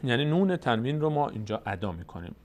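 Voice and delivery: ordinary delivery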